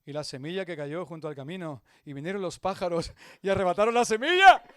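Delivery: recalling the verse mid-scene; quoting scripture. Retold or imitated. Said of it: "lo primero que me viene a la mente".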